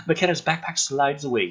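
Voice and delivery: ordinary delivery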